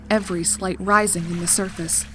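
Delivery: usual speaking voice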